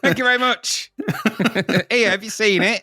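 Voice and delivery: high, hammed-up acceptance voice; jokey medal winner. Acting out a gold medal winner.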